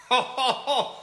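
sudden delighted laugh. Real, not acted. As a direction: burst of high laughter. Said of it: "Was gibt's denn da zu lachen?".

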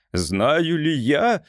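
drawn out in disbelief; exclaiming. A narrator's reading. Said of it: "воскликнул офицер".

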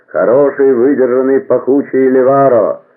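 high, loud hawker's call, easing at the end; cheese seller's cry. A narrator's reading. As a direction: call out your cheeses item by item. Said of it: "продавец сыра кричал".

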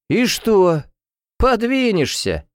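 high, drawn-out cocky taunt; insolent. A narrator's reading. Drunk student taunts her defender, insolent. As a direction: brazenly brush the rival aside. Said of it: "Нагло засмеялся воздушник".

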